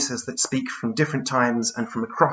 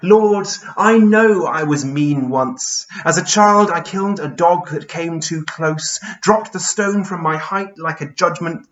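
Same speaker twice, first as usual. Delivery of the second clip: raised, emphatic confession in verse; Charles Byrne, confessing; 4 semitones higher, 5 dB louder, slower than usual. Acted. Giant confesses childhood cruelty to the Lord, anguished poetic confession. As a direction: call out to God, drawing out the opening.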